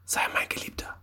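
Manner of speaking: very soft, voiceless whisper; hushed, secret invitation; seductive whisper. A narrator's reading. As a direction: whisper it intimately to a would-be lover. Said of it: "flüsterte sie Josef zu".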